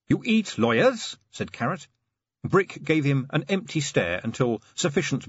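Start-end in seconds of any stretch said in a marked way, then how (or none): 0.1-1.2 baffled. Acted. Carrot baffled by troll.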